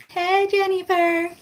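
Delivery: drawn-out high hello; cheery greeting. Real, not acted. Greeting a live viewer by name, drawn-out and high. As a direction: sing out the name warmly.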